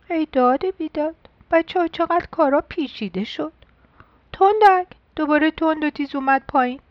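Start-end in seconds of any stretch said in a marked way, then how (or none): none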